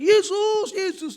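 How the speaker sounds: high, loud call of the name; praying aloud as the nurses open the curtain; shouting Jesus. Retold or imitated. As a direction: cry out the name in prayer. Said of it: "kan lagi teriak".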